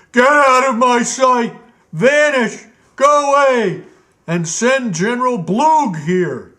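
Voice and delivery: high, loud tyrant king yelling; furious royal shout. Acted. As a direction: drive the steward out in a rage. King throws ruby, screams.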